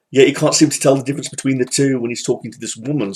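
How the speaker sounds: ordinary talk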